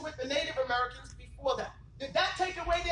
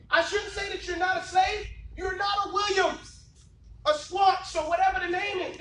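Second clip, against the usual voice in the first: preaching shout; 5 semitones higher, 4 dB louder than usual. Real, not acted. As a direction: drive it home with a shout.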